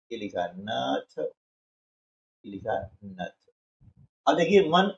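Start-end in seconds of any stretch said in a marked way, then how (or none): 0.1-1.2 slow, careful reading of the word नथ. Shown as a demonstration. Teacher to young pupils, reading नथ slowly. 1.2-3.5 very slow drawn-out reading. Shown as a demonstration. Teacher to young pupils, drawing out नथ very slowly and softly.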